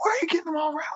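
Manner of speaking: very high, soft mimicked scolding; complaining wife. Wife to husband riling kids at bedtime, complaining. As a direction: put on a small falsetto nagging voice.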